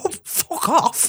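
high, slow laughing fuck off; giggling brush-off to the sloth; laughing dismissal. Pretend. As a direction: stretch it out while cracking up. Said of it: "I'm like".

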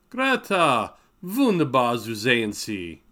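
high, loud, delighted greeting; exclaiming. A narrator's reading. Block greeting Greta at the door, loud high delight. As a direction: burst out in joyful welcome. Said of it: "he exclaimed".

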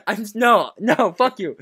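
usual speaking voice

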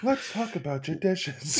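slow, drawn-out mock of the host; mocking Padma. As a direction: imitate her awkward dubbed line slowly. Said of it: "that awful A D R of her being like".

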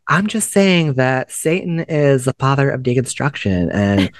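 sweet, earnest clickbait video narrator. Retold or imitated. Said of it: "YouTuber voice where they talk like".

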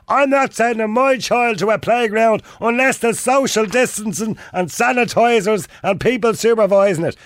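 high-pitched, drawn-out mock Dublin parent; Dublin accent. Host voicing parents online objecting to playgrounds, mock Dublin accent. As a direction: put on an exaggerated slow, high Dublin voice. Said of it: "why do you always do it in a Dublin accent".

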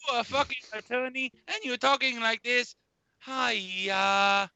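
a lightly put-on character voice for the whole rant; a hint of a goofy character voice, ranting at a friend. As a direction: stay in the silly put-on voice. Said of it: "I'm going to do this voice".

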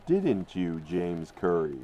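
ordinary talk, nothing marked

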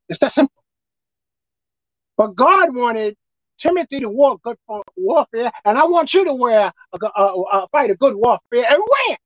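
normal talking voice

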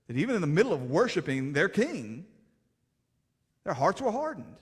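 preaching at full force. Acted.

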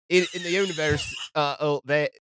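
wobbly mock-British reading; attempted British accent. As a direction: have a stumbling go at a British accent. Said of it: "Hold on, pick an accent".

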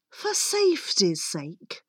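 ordinary delivery

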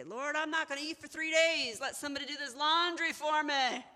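high, loud, hammed-up plea to God; mock desperate prayer. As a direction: wail it to heaven in mock earnest. Acting out an absurd laundry prayer.

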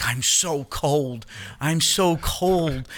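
slow, dragged-out whimper of a freezing mother; whiny cold mother. Retold or imitated. Mother by the fire moaning to the room, slow and whiny. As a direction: stretch each word out like a miserable old mom.

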